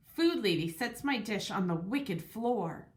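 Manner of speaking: usual speaking voice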